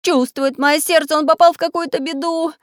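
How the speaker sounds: high, anxious voice; worried tin man. Acted. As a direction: fret aloud. Samodelkin worried.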